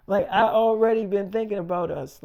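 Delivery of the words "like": ordinary talk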